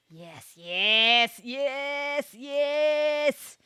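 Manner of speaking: loud, elated repeated yes; excited yes. Real, not acted. Streamer thrilled to find the lost album, loud happy yeses.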